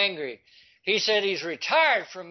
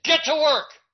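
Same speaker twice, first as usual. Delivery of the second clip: sharp, loud and high command; father barking at his son to get working, loud and stern; barked order; 10 semitones higher, 7 dB louder than usual. Retold or imitated. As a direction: snap the order like an angry father. Father ordering son amid emergency.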